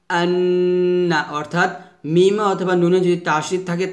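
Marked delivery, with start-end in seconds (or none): 0.1-1.2 আন্না with a long nasal hummed n; slow আন্না, humming through the nose; ghunnah demo. Shown as a demonstration. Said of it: "নাকের বাসি হয়তো গুন্না উচ্চারিত হয়".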